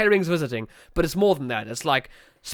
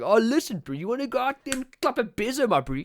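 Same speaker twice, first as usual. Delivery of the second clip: put-on higher-pitched, swaggering South African bro accent; Joburg Chad accent; 5 semitones higher than usual. South African mocking Joburg bros, put-on Joburg Chad accent. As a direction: imitate a cocky Joburg lad in thick local accent. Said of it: "the real Chads of our, of our country".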